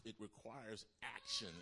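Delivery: ordinary talk, nothing marked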